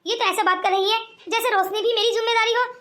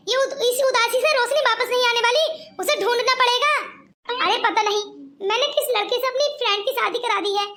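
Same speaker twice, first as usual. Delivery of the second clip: ordinary talk, nothing marked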